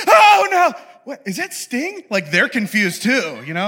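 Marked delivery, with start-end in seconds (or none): 0.1-2.0 very high, loud gasp then question; hammed-up fright; mock scared shepherds. Shepherds seeing the angel, mock scared. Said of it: "They're like".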